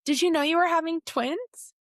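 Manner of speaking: surprised tech. Retold or imitated. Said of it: "she said".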